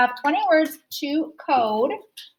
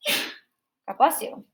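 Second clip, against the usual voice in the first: usual speaking voice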